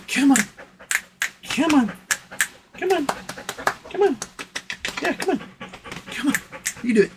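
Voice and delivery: high, soft sing-song coaxing; coaxing the dog. Real, not acted. Owner coaxing dog out of her cave, high and soft. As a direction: lure her up gently with a high voice.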